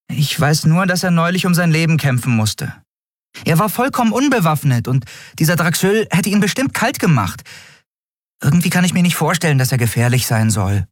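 unsure but sympathetic; a hint of a hesitant girl; lightly voiced Nelen. A narrator's reading.

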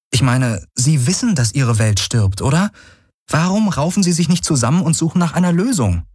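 high-voiced, baffled appeal; incredulous heroine. A narrator's reading. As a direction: plead for sense, pitch climbing. Heroine questions a senseless war.

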